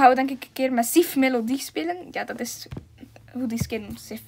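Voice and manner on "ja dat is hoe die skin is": soft unvoiced whisper. Real, not acted. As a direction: whisper it under your breath. (zacht gefluisterd)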